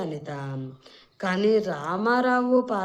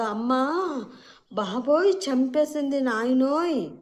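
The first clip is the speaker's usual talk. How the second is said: higher, louder wail of pain; crying out in pain; 6 semitones higher, 3 dB louder than usual. A narrator's reading. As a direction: howl like you've been punched. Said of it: "గట్టిగా అరుస్తూ".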